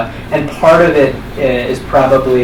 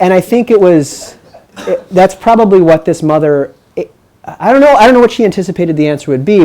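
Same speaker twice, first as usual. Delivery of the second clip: usual speaking voice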